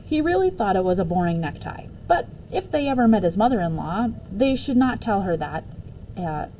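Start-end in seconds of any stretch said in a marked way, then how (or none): none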